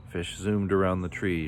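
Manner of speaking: ordinary delivery, nothing marked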